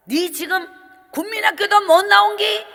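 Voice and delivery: mother screams at her daughter teaching; mother screaming. Retold or imitated. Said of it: "이렇게 소리 질렀다는 거 아닙니까".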